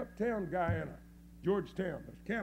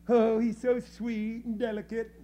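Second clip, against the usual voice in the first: louder, put-on admiring coo; mock gushing; 2 semitones higher, 6 dB louder than usual. Preacher mimicking everybody praising the grinning pastor, mock gushing. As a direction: gush in imitation of adoring fans.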